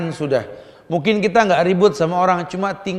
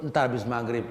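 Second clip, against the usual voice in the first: usual speaking voice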